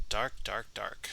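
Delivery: breathy, unvoiced repeated whisper. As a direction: whisper it like a spooky incantation. Eerie whispered repetition.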